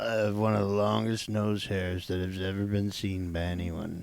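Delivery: put-on low, quiet old man voice. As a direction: talk like a creaky old man. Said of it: "is that an old man voice".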